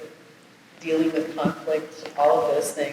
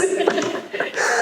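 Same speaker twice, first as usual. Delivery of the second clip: short high laugh at herself; rueful little laugh; 12 semitones higher than usual. Real, not acted. Laughs at her own admission.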